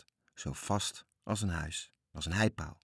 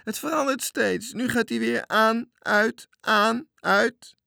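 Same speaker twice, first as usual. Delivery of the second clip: very high, very loud alarmed yell; shrieking; 8 dB louder, slower than usual. A narrator's reading. Frightened lookout reports the changing signal, shrieking. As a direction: scream out each change of the light. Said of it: "Maar plotseling gilde hij".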